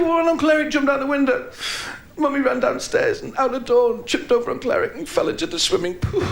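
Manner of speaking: put-on high child's hello; little girl voice. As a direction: answer as the small daughter. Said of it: "the little voice".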